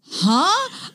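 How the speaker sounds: high, confused "Huh?"; a high, puzzled huh; baffled huh. Retold or imitated. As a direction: react like it makes no sense. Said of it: "And I was like".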